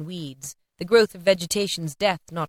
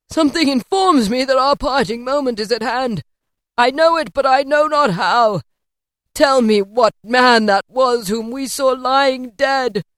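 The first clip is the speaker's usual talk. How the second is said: put-on higher, louder urgent old man; lightly voiced Scrooge; 6 semitones higher, 8 dB louder than usual. A narrator's reading. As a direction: press the spirit for an answer. Scrooge voiced, pleading ghost.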